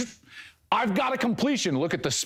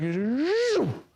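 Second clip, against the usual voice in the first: mock zoom; 6 semitones higher than usual. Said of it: "he just calmly is just like".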